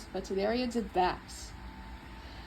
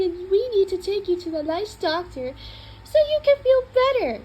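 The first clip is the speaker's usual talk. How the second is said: put-on higher, louder syrupy baby talk; sweet coaxing voice; 7 semitones higher, 5 dB louder than usual. Shown as a demonstration. Owner sweet-talking the cat into the carrier, high cooing. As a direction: coo at the cat like a doting owner. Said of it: "with a soft, gentle, supportive tone of voice".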